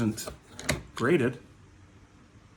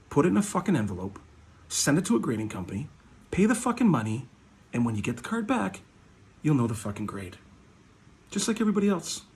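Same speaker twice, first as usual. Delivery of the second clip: ordinary talk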